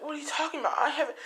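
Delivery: put-on very high-pitched girlfriend voice; acted defensive denial. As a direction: deny it in a high, flustered voice. Rose denies planting cameras.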